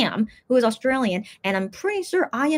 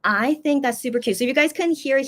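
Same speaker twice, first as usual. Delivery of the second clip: usual speaking voice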